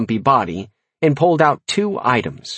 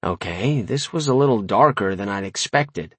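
plain reading voice

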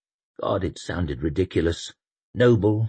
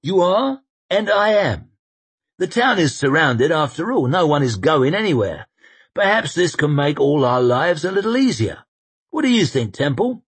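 raised, high wry general; amused command, a notch louder; 10 semitones higher, 6 dB louder than usual. Acted. Koska deciding in front of everyone, wry and raised.

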